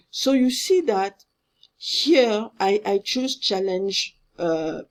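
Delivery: ordinary talk, nothing marked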